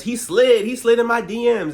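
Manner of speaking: high, loud, ridiculing repeat; mocking imitation. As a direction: mimic her whiny complaint, loud and high. Mocking Gizelle's complaint.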